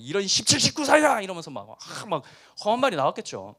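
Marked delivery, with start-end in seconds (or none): 0.0-1.2 loud, angry minced curse; mock swearing. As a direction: bite off a fake curse word. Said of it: "험한 말이 막 입에서 나왔겠죠". (화나서 내뱉는 가짜 욕설)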